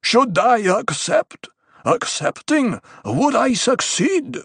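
slow, high, drawn-out shout; shouted question. A narrator's reading. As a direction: call each question out deliberately. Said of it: "a voice shouted from off to the right".

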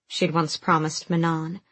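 ordinary delivery, nothing marked

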